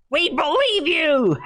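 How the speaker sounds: high, drawn-out mock shout; mock cheering. Host jeering at the old man's claim, mock cheering. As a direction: cheer it in a silly high voice, stretching the words. Sarcastic cheer at Biden's words.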